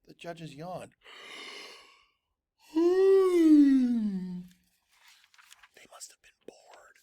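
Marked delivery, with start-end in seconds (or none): 2.7-4.4 long bored sigh. Acted. 5.8-7.0 unvoiced whisper. A narrator's reading. Whispered aside.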